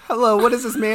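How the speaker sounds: high, loud mock monk; put-on high-pitched greeting; voiced monk. Acting out monk approaching killer.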